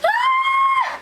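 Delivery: sudden high shriek of disbelief; a loud shriek at the naivety; exasperated shriek. Real, not acted. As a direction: let out a sharp high yelp of frustration. Exasperated at Caleb's naive belief.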